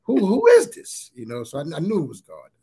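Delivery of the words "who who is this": startled question. Retold or imitated. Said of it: "'cause I'm like".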